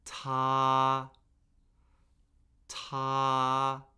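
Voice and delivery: "Ta" held high and flat; first tone. Shown as a demonstration. Teacher modelling tones for repeating, "Ta" high and flat. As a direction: say "Ta" on a high, level, unchanging pitch. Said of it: "high, flat, and unchanging".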